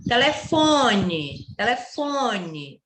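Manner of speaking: "Telefone" with a very long FO before ne; slow right down on the third syllable of "Telefone"; stretched nasal FON in "Telefone"; lingering on FON. Shown as a demonstration. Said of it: "olha quanto eu demorei no fon".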